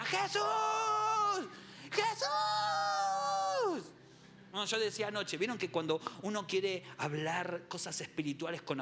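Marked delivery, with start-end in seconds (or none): 0.0-3.9 very high, long-held comic yell; shouting. Acted. Disciple interrupting Jesus, high drawn-out shout. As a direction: scream the name in a shrieking falsetto. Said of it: "salta Pedro y grita".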